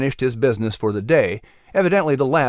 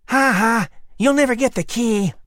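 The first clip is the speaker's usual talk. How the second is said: taunting lizard; 8 semitones higher than usual. Acted.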